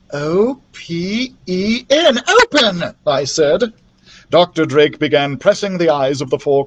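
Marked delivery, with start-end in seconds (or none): 0.1-3.0 slow spelling out. A narrator's reading. Spelling out the answer.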